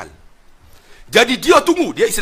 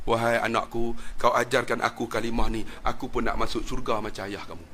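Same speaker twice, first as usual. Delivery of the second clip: softer, lower, gentle request; tender plea; 5 semitones lower, 6 dB quieter than usual. Retold or imitated. Mother asking son to teach her the creed, tender. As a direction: ask humbly like a mother.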